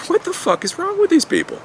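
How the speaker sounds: high-pitched baffled what-the-hell; incredulous outburst. Retold or imitated. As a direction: react like you can't believe the paperwork.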